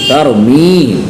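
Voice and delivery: तरमीम drawn out on a long "mee"; correct long vowel. Shown as a demonstration. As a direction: stretch the middle of तरमीम. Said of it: "तरमीहिम नहीं है". (तरमीम में मी खींचकर)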